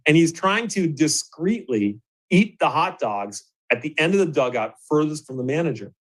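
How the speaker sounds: usual speaking voice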